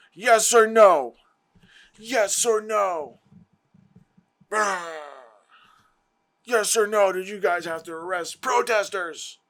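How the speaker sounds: pressing a witness in a hearing, mock insistent; mock interrogating questioner. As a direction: drag out each word like a badgering questioner. Acted hearing, questioner demanding answers.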